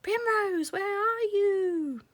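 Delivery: very high, slow far-off call; echoing call. A narrator's reading. The echo carries over the cornfield, distant high call. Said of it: "echoed the call across the cornfield".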